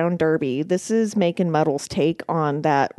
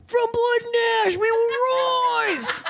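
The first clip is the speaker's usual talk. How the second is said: slow, high, laughing surfer shout; surfer boy voice; slower than usual. Retold or imitated. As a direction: yell it like a stoned surfer. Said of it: "you did sound like a surfer boy".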